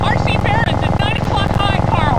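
loud urgent warning yelled over the fight; battle shout. Acted. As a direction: shout the alarm across the battlefield. Yelling warnings during toy dogfight.

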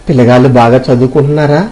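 ordinary delivery, nothing marked

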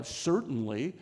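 ordinary talk

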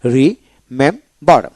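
রিমেম্বার in three slow, separated syllables. Shown as a demonstration. Teacher counts syllables for learners, saying রিমেম্বার slowly in three parts. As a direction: break রিমেম্বার at each syllable, pausing between. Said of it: "এখানে তিনটা syllable হলো".

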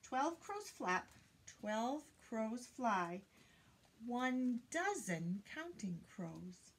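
slow, deliberate repeat, dozen marked. Shown as a demonstration. Teacher repeating the rhyme slowly to point out dozen. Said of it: "Did you hear that word".

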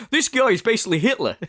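quick, laughing mock outrage; laughing retold quote. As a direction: quote him through your laughter. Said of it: "He was like".

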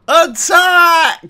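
very high, loud charge yell; battle cry. Pretend. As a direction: scream the order to charge. Commanding troops in game.

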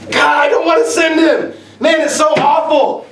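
high mock shout of exasperation; exasperated outcry. Boss stuck with a lazy worker, exasperated mock shout. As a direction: cry out to God, fed up.

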